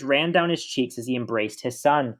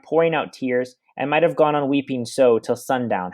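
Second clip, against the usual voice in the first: ordinary talk, nothing marked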